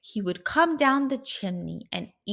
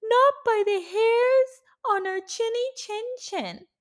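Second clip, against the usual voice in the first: high squeaky little pig; 6 dB louder than usual. A narrator's reading. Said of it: "so they answered back".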